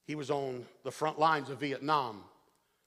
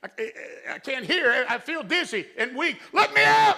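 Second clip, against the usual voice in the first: high, loud desperate cries to be let out; panicked screaming; 10 semitones higher, 11 dB louder than usual. Retold or imitated. Trapped soldier begging to be let out, screaming high. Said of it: "he started screaming at the top of his lungs".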